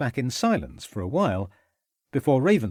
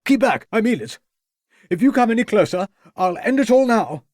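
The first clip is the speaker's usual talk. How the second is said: much higher, louder desperate threat; agitated youth shouting; frantic threat; 10 semitones higher, 7 dB louder than usual. A narrator's reading. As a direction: threaten to end it.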